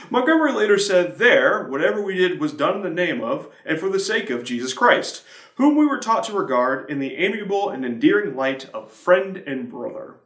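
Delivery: usual speaking voice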